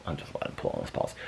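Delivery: soft hushed whisper. Real, not acted. Owner whispering about her dog pulling his paws. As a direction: whisper so you don't disturb him.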